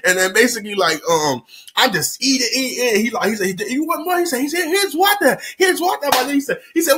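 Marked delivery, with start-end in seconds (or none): 3.6-6.1 high, eager host voice; lightly voiced generous host; imitating the restaurant guy. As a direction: pitch up and push the food on him.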